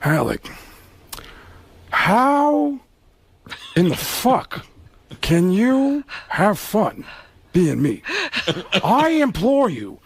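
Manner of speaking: slow, anguished Pacino rasp breaking high; Al Pacino impression. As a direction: plead it slowly, wounded and theatrical. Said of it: "Pacino looked at him and said".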